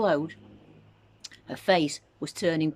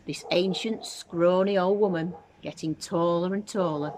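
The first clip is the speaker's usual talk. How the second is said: ordinary delivery, nothing marked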